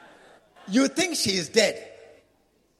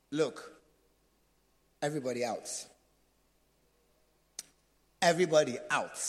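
Jesus commanding; 7 semitones lower than usual. Acted. Jesus sends the mourners out, low and firm. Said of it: "Jesus said".

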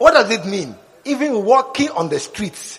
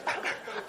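laugh; 12 dB quieter than usual. Real, not acted. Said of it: "why are you laughing?".

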